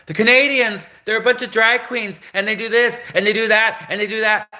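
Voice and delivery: very high-pitched mock panic; panicky. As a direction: sound the alarm in hammed-up hysteria. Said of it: "panicky enough".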